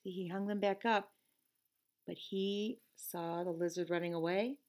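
ordinary delivery

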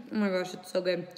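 normal talking voice

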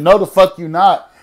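loud, high indignant retort; loudly acted-out defiance; loud refusal. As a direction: push back hard, raising your voice. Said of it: "talking loud".

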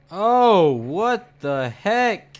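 high, loud startled cry; panicked, drawn-out yelp of fright; scared horror game streamer. Real, not acted.

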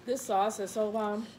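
talking while eating; chewing. Real, not acted. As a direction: mouth partly full. Seen 1: Woman wipes mouth, jaw moving after eating corn; speech mid-chew.

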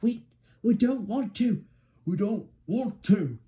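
high, whiny reluctant trucks; grumbling. A narrator's reading. Trucks refuse to hurry for Percy, grumbling. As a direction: protest in a high, complaining character voice. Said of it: "they grumbled".

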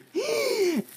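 very high, sharp gasp; delighted gasp. Real, not acted. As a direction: gasp as if you can't believe it. Sees the next gift.

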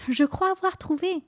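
put-on high, bright little girl's idea; excited cry. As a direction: exclaim it like a girl with a plan. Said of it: "s'écria tout à coup".

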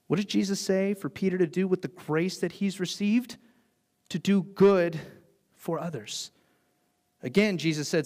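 ordinary delivery

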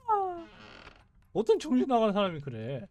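ordinary talk, nothing marked

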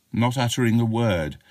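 plain reading voice

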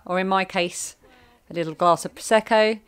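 ordinary talk, nothing marked

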